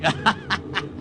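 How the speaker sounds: a short chuckle at his own gag; self-amused chuckle. Acted. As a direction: laugh at your own corny joke. Laughs at own joke.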